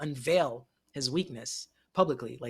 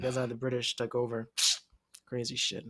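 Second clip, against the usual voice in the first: normal talking voice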